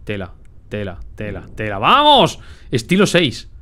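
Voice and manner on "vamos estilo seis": loud, high triumphant vamos; excited cheer. Real, not acted. Gamer cheering after smashing the furniture, loud and high. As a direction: celebrate the win out loud.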